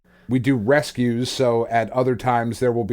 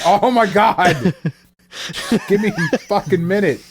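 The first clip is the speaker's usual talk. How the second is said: exasperated dad; 6 semitones higher than usual. Acted. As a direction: act out a dad begging for a moment's peace. Acting out exasperated dad on toilet.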